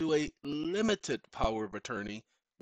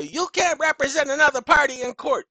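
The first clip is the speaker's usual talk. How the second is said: much higher, louder mock official voice; mocking the courts; 10 semitones higher, 9 dB louder than usual. Mimicking the court telling him he can't represent her. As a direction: parody the court's stern refusal. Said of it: "when the courts say".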